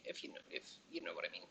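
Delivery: fast, hushed, knowing whisper; whispered aside. Real, not acted. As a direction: drop to a quick sly whisper. Sly innuendo aside to viewers.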